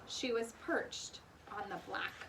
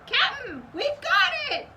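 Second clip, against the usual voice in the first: loud, much higher triumphant shout; excited shout; 13 semitones higher, 11 dB louder than usual. A narrator's reading. Pinky shouts to the captain, loud and triumphant. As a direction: yell it out in triumph. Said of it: "shouted Pinky".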